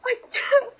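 a choked, tearful cry; wife breaking down crying; breaking into sobs. Acted. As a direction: she crumbles into tears.

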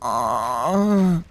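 ordinary talk

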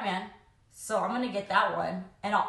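normal talking voice

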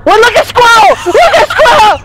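high shouting, rising frantic; excited shout. Real, not acted. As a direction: yell as your friend is caught. Teammate caught by monster.

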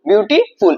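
বিউটিফুল in two separated beats, বিউটি and ফুল; syllable demo. Shown as a demonstration. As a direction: pause briefly inside বিউটিফুল, before ফুল. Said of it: "দুইবারে উচ্চারণ হচ্ছে".

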